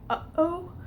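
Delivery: "uh-oh" with a sharp catch in the throat between the two syllables; "uh-oh", the sound cut off abruptly in the middle; glottal stop. Shown as a demonstration. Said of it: "that sound in the middle where you just kind of abruptly shut off the noise".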